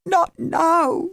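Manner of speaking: high, drawn-out wail; girl wailing at her disbelieving family. A narrator's reading. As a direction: wail it out in distress. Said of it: "she wailed".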